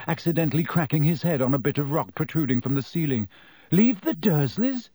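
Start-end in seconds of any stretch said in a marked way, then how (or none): 3.7-4.9 high-pitched, slow incredulous question; astonished. Acted. Harry realizing he could leave the Dursleys, incredulous. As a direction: draw it out in disbelief.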